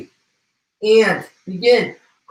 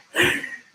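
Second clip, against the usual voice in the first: soft high giggle; a small helpless giggle; 9 semitones higher, 6 dB quieter than usual. Real, not acted. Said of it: "I'm just laughing, guys".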